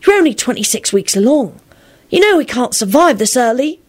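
high, stern midwife voice. A narrator's reading. Midwife warning Elizabeth of early birth, stern high voice. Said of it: "she said sternly".

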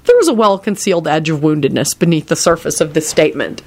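ordinary delivery, nothing marked

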